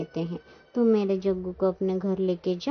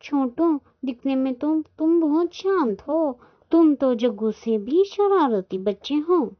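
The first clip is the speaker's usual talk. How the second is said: higher-pitched, exasperated telling-off; mild scolding; 7 semitones higher than usual. Acted. Aunt scolds Chhotu over the traded sari, mild exasperation. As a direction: scold a child with a touch of dismay.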